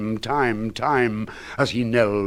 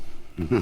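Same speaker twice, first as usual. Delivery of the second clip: a brief low unhinged chuckle; short mad chuckle; 3 semitones lower, 5 dB quieter than usual. Acted. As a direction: laugh to yourself. Mad narrator laughs.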